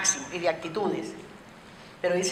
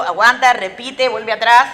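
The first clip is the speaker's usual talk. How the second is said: called out loud, like an audience member stopping her; loud call to stop; 11 dB louder, slower than usual. Acted. Teacher voicing a listener calling out loudly to slow her down. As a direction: shout it as if interrupting the speaker.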